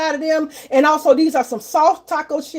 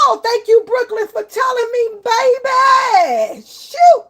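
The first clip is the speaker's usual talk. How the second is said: high, animated outburst of thanks; excited exclamation; 9 semitones higher, slower than usual. Real, not acted. Streamer thanking Brooklyn for the mute warning, high and exclaiming.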